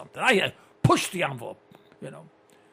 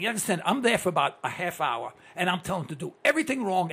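normal talking voice

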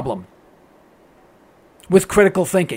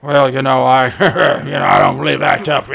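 much lower, dopey dismissive voice with a chuckle; mock average American; 10 semitones lower than usual. Host mocking average Americans ignoring advice, low dopey voice. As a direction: play a know-nothing brushing off advice. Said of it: "what is the first thing we do?".

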